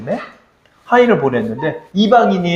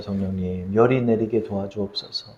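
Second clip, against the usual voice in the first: soft, hushed plea to God; hushed prayer; 9 semitones lower, 10 dB quieter than usual. Retold or imitated. Parent praying softly by a feverish child's bed. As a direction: pray softly over a sleeping child.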